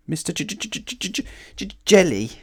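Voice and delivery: terrified stuttering; nervous stammer. A narrator's reading. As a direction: stick repeatedly on the first sound, then push it out. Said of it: "stammered Mister Jelly".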